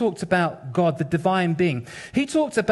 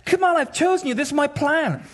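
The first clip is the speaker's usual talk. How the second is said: God pressing Moses, pitched way up; insistent God; 9 semitones higher than usual. Retold or imitated. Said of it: "And, and God says".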